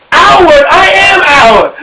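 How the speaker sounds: high, loud indignant disbelief; hammed-up outraged question; mock indignant. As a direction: push back, offended. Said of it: "I'm like".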